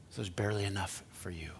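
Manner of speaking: hushed, conspiratorial whisper; stingy whisper. Acted. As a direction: whisper it like hoarding candy.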